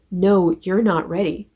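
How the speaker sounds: normal talking voice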